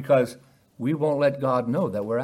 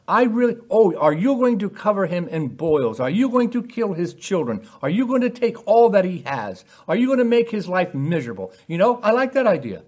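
high, loud, incredulous mock delight; mock approval; 5 semitones higher, 4 dB louder than usual. Preacher voices a Lord approving Job's suffering, mock enthusiasm. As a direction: act out absurdly eager approval.

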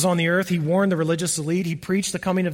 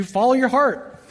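mocking slogan; 6 semitones higher, 4 dB louder than usual. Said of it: "That has changed to".

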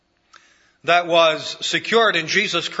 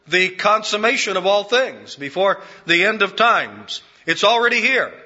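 preaching build; 5 semitones higher than usual. Acted. Preacher rousing the congregation to urgency, building climax. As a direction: climb to a fervent peak.

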